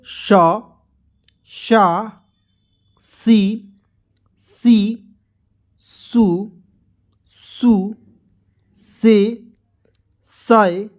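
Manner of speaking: one drawn-out syllable at a time, long pauses between; syllable-chart recitation. Shown as a demonstration. Hindi teacher reciting the barakhadi to learners, syllable-chart recitation. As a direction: recite each letter form deliberately for learners.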